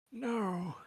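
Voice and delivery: ordinary talk, nothing marked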